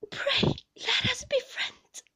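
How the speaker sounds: slow, high, tearful appeal; pleading. A narrator's reading. Mother begging her husband to be friends, high and pleading.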